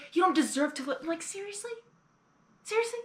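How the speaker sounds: high, incredulous "seriously?"; can't believe what they're doing; exasperated disbelief. Real, not acted. Viewer ranting at changelings over Fluttershy, exasperated disbelief.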